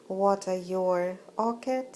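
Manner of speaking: ordinary delivery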